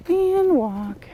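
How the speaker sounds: high, soft command to walk; soft voice cue to the horse; gentle cue. Real, not acted. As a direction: ask the horse to walk, lightly lifted.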